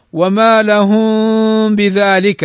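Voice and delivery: slow recitation with the م of لهم hidden in a nasal hum before ب; hidden meem. Shown as a demonstration. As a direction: glide "لهم بذلك" with the م hidden and nasal, no closed lips. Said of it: "هذا على الإخفاء".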